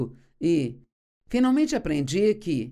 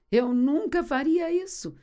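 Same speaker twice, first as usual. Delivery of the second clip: higher-pitched, insistent mock denial; emphatic protest; 8 semitones higher than usual. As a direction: act out an overconfident denial, pitched up. [name] repete o protesto, mais enfático. (protesto enfático, em tom mais agudo)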